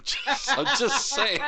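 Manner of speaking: giggling through the words, high; can barely get it out for laughing. Real, not acted. Host laughing at his own Trudeau joke, giggling.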